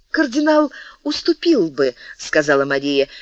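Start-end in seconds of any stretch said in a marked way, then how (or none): 0.1-1.9 urging. Acted. Мария убеждает короля.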